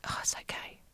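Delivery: whispered softly, soothing; comforting whisper. Retold or imitated. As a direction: hush her gently like a kind nurse. Nurse comforting her, touching leg.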